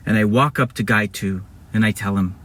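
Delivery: usual speaking voice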